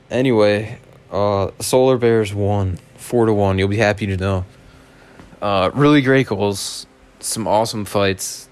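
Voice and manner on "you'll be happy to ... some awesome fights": ordinary talk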